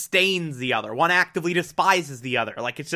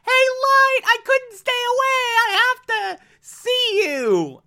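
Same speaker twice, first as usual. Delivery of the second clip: put-on much higher, breathy-sounding girlish gush, slowing at the end; gushing Misa voice; 3 dB louder than usual. Misa rushing up to Light, gushing in a high girlish voice. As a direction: play the smitten girl who can't stay away. Voicing Misa's gushing arrival.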